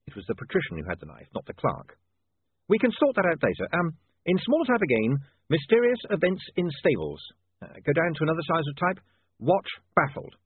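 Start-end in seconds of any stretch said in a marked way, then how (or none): 2.7-10.4 high, deliberate dictation to a typesetter; thinking out loud in bold headline style; William dictating headlines. Acted. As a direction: compose it aloud, drawing out the headlines.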